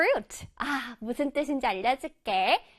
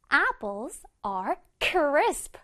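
"Apples are crisp" very slowly, word by word; slow model sentence; much slower than usual. Shown as a demonstration. Teacher reading the example sentence slowly to kids. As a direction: spell each word out slowly for the class. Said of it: "One more time".

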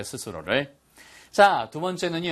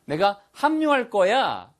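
acted snap resolve, pitched up; spur-of-the-moment decision; 6 semitones higher, 5 dB louder than usual. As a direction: blurt out a sudden decision to join. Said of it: "순간적인 결심이죠".

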